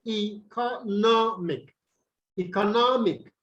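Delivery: economic drawn out slowly, NOM stressed. Shown as a demonstration. Teacher repeats the word for students, stressing NOM. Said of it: "the syllable before the last".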